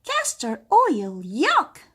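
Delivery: high, loud, slow disgust; disgusted cry. Acted. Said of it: "cried Princess Finiver, Missus Green".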